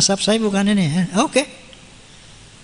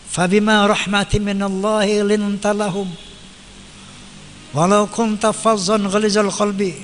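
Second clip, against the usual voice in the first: Quran recitation. Acted. Preacher recites a Quran verse to the congregation, chanted. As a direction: intone the verse reverently, drawing out the vowels.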